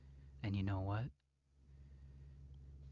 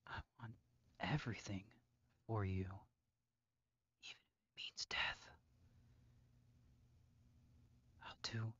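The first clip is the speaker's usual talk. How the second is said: soft, hushed obsessive vow; creepy whisper; 3 semitones lower, 12 dB quieter, faster than usual. Acted. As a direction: breathe it close and low. Whispers devotion to captive.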